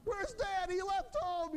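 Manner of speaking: high-pitched mock panic of a child; kids freaking out. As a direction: wail it like a kid who lost Dad. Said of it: "Otherwise, they freak out".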